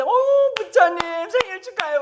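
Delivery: high, loud put-on congratulations; mock cheery greeting. Preacher mimicking temple-goers on Buddha's birthday, mock cheer.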